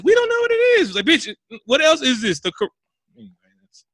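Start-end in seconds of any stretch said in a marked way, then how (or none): 0.0-0.9 squeaky, mocking falsetto; mocking high voice. Mocking the clueless characters in a falsetto. 1.0-2.7 exasperated retort. Retold or imitated.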